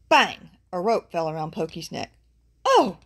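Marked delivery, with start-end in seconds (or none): 0.1-0.5 loud, abrupt exclamation; sudden bang. A narrator's reading. Rope suddenly lands on the burro, loud exclamation. 2.6-3.0 loud, high yelp of surprise; startled cry. A narrator's reading. Roped burro falls to the ground, high startled cry. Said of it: "he cried as down he fell".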